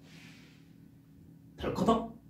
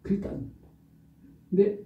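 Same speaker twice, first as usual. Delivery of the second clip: ordinary talk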